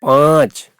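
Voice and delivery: पाँच with a long आ through mouth and nose; long nasal vowel in पाँच, no separate n; nasalized vowel. Shown as a demonstration. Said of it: "मुख और नाक दोनों से निकलती है".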